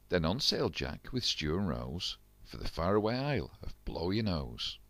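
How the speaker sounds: usual speaking voice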